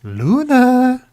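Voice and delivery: high, drawn-out call for a lost pet; a sing-song high call for Luna; calling the cat. Real, not acted. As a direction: sing the name out high to find her.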